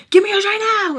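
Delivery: loud, high-pitched urgent demand; pissed-off shout. Retold or imitated. Woman to Spice after her hair tie breaks, angry shout. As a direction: yell the demand, voice shooting up. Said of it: "I'm, like, pissed".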